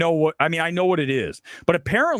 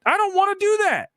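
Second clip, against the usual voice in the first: whiny complaint; 13 semitones higher than usual. Real, not acted. Whiny complaint about the film.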